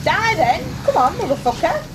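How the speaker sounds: high loud combat yelling; angry yell. Real, not acted. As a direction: scream at the enemy. Fighting enemy in game.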